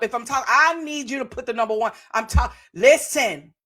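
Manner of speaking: ordinary talk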